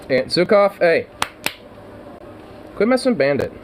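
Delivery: high-pitched telling-off aside; calling out a scolding. Real, not acted. Telling Zukov to quit messing with Bandit, high-pitched scolding.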